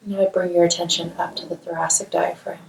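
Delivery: calm, measured meditation voice with long pauses; guided meditation. Acted. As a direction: guide them gently through a body scan, leaving space to breathe. Leads a guided mindfulness meditation.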